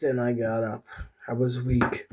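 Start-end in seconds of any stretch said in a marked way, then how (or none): none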